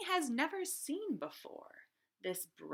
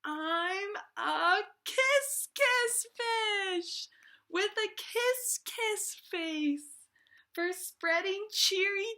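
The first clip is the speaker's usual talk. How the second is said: much higher, louder, slow bright chant; cheery refrain, much higher and louder; joyful kiss fish; 10 semitones higher, 8 dB louder, slower than usual. Acted. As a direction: burst with glee like a fish in love. Happy fish sings new refrain.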